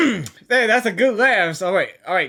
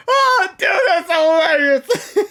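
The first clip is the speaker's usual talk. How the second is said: laughing; 6 semitones higher than usual. Real, not acted. Laughing at the nicknames.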